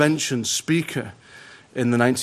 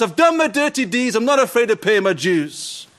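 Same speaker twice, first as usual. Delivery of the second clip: higher, defiant boast; bold as brass; 8 semitones higher, 3 dB louder than usual. Retold or imitated. Condemned man boasting from the dock, defiant. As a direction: brag it fearlessly. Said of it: "as bold as brass".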